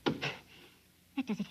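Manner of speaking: a slow effortful whisper under his breath; strained whisper. Acted. Prisoner straining at the cage lock, whispering. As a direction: whisper it while forcing something loose. Straining free after a climb.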